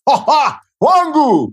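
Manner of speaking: mock hearty greeting.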